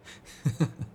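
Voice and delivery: brief, breathy laugh; a quick teasing chuckle; short chuckle. Acted. Vampire needling the hunter over blame, short chuckle.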